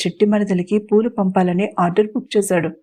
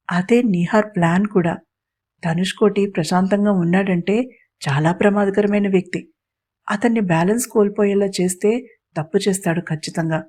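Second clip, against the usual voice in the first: plain reading voice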